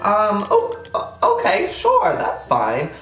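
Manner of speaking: high, hesitant stammer, slowing at the end; flustered stammer. Retold or imitated. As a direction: stumble into an unsure yes. Nervous reply to meeting offer.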